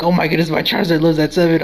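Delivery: blurting out surprise that Charizard survived; excited disbelief. Retold or imitated. Retelling the battle, blurting excitedly that Charizard survived. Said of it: "I was like".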